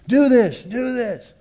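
high, loud urgent order; yelling command. Acted. The Lord's voice yelling at believers, loud high command. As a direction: call it out firmly. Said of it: "yelling at us".

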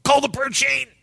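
hammed-up alarm; mock panic. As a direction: squeal it like a panicked churchgoer. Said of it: "panic and say".

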